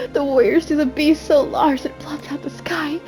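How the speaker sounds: ordinary delivery, nothing marked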